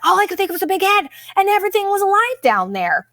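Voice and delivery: high, fast, frantic mock panic; retelling the snorkel panic in a high, frantic voice; panicked. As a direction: blurt it out like you just fled the water. Retelling her panic to laughing guys.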